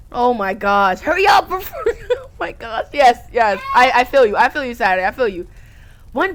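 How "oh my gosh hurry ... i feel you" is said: ordinary talk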